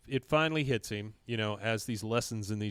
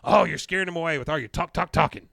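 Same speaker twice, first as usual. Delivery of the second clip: higher, louder, rushed mock scolding; loud, pitched-up retold nagging; 4 semitones higher, 9 dB louder, faster than usual. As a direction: act out an exasperated telling-off. Retold scolding of someone talking.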